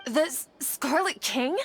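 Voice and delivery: slow stammering gasp at the name; stunned surprise. Acted. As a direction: stumble over the name in shock. Shocked at the name.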